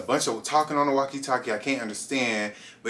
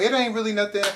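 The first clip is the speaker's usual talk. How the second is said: high, innocent little denial; mock innocent; 10 semitones higher, faster than usual. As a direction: play it cool and innocent, much higher. Playing innocent to searching guard.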